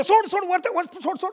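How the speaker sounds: high calling yell; scolding woman calling out, let go; teacher yelling. Retold or imitated. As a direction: shout at the boy to let go. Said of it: "बाई ओरडते".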